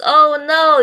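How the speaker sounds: mock dismay. Acting [name]'s dismay.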